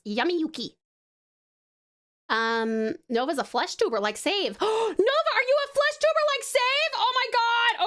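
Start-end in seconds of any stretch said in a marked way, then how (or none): 4.6-7.9 surprised gasp. Real, not acted. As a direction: gasp as if you just found out. Gasps on learning Nova's a tuber.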